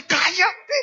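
loud, high hushing snarl; angry husband. Retold or imitated. Abusive deacon silences his wife about the neighbours, angry shout. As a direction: hiss him down then rise to a shout.

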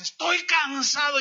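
high, loud "Estoy cansado"; anguished cry. Retold or imitated. Gunman slamming down the pistol, crying out loud. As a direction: cry it out, worn to the breaking point.

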